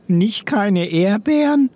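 high, slow creature voice, puzzled; a drawn-out question, as if thinking it over; pondering chameleon murmur. A narrator's reading. Rosso pondering the ban on strawberries, high slow murmur. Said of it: "murmelte Rosso, und es klang, als müsste er".

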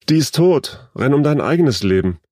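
high, loud urgent yell; yelled command. A narrator's reading. Egon urges Lene away from the body, yelling. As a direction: yell at her to flee. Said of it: "brüllte Egon neben ihr".

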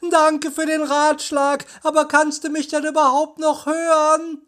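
high-pitched call across a distance; calling back. A narrator's reading. Peter calling back to Justus in the tunnel, high calling. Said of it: "Schnell rief Peter zurück".

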